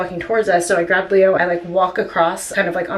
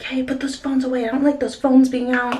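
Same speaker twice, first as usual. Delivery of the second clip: higher mock of a ranting man; mock yelling stranger; 5 semitones higher than usual. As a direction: pitch it up like the man barking orders. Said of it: "He's like".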